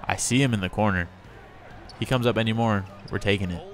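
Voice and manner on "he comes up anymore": ordinary talk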